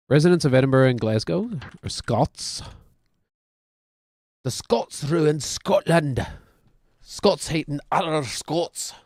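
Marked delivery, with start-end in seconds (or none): 4.4-8.9 higher, drawn-out Scottish accent. Shown as a demonstration. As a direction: put on a slow, hammy Scottish accent. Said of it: "all I can do in Scottish".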